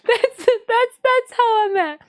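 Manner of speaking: high laugh into words. Real, not acted. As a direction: giggle through the line. Laughing after being winded.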